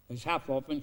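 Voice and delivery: normal talking voice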